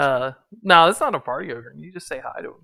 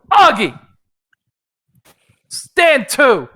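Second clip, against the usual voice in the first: barked order; 7 semitones higher, 6 dB louder, slower than usual. Acted. Sergeant calls ogre to attention.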